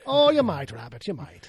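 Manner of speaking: lightly voiced Irish accent; playful bad Irish brogue. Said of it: "It's an Irish accent".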